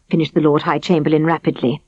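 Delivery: ordinary delivery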